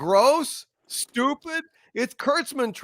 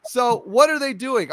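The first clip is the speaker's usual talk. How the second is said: ordinary talk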